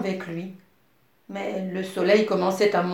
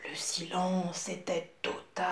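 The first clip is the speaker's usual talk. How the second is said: hushed whisper; 8 dB quieter than usual. A narrator's reading. Hushed suspense in the story.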